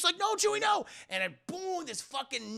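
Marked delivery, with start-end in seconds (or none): 0.2-0.9 high-pitched cry of alarm; alarmed warning. Acted. Luke stopping Chewie at the trap, alarmed cry. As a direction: cry out to stop him, voice way up.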